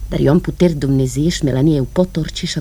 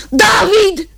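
high calling shout into emptiness; shouting a name; 13 semitones higher, 5 dB louder than usual. Acted. Woman calling her lost brother, shouting high. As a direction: call out loud to someone far away.

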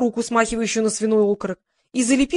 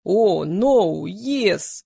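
bass voice; much slower than usual. A narrator's reading. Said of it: "басом произнёс Игорь".

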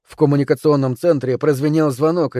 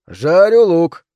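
loud, higher call from the kitchen; 5 semitones higher, 7 dB louder, much slower than usual. A narrator's reading. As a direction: announce it loudly. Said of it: "громко сообщил я".

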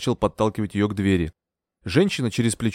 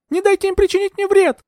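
high, loud appeal for help; very high, panicked cry; 5 dB louder than usual. A narrator's reading. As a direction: beg for protection at a high pitch. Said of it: "крикнул я ему".

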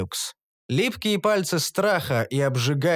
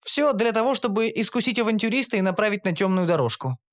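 higher, informative female voice; explaining Shantri; 7 semitones higher than usual. A narrator's reading. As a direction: explain the goddess patiently. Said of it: "пояснила Шантри". (Шантри объясняет высоким голосом)

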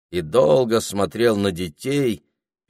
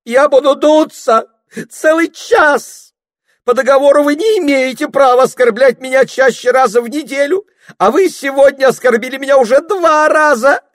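mock sobbing complaint; 11 dB louder than usual. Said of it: "снова принимаясь рыдать".

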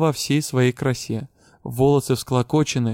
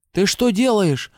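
higher, slower outraged roar; 6 semitones higher, 3 dB louder, slower than usual. A narrator's reading. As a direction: roar at her in alarm. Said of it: "взревела Дара". (взревела Дара)

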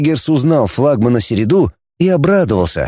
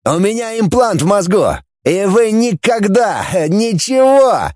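worked-up, high-pitched near-shout; almost shouting; 8 semitones higher than usual. A narrator's reading. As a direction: boast defiantly at your captors. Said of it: "почти кричал Фанас".

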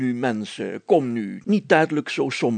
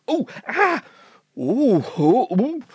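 high strained groans of effort; pained groans; 9 semitones higher, much slower than usual. Acted. Policeman groans while getting up on his legs. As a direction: groan as you haul yourself upright.

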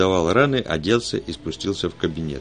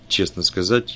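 plain reading voice

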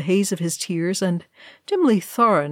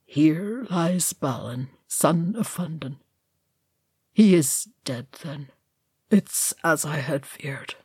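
heavy with sorrow; 3 dB quieter than usual. A narrator's reading. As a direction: read the runes in quiet grief. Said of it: "in a voice heavy with sorrow".

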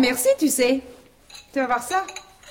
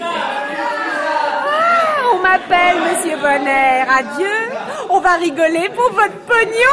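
higher, louder mocking farewell; triumphant taunt; 6 semitones higher, 6 dB louder, slower than usual. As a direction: wave him off with glee.

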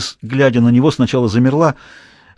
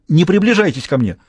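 frightened; 6 semitones higher than usual. A narrator's reading. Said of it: "в ужасе произнесла она".

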